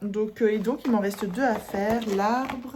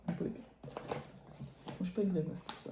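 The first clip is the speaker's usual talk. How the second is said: whispered aside; 12 dB quieter than usual. Real, not acted. Self-deprecating aside to viewers.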